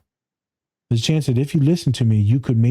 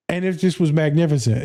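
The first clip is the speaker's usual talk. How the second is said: ordinary delivery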